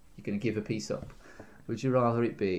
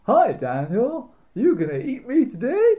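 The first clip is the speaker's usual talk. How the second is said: high, slow, loud piggy voice; squeaky eager ham; talking ham voice; 12 semitones higher, 7 dB louder than usual. Pretend. Voicing the living ham.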